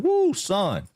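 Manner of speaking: high Southern whoop, hammed up; real Southern talk. Streamer reacting to thick local talk, mock Southern accent. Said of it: "that real Southern talk".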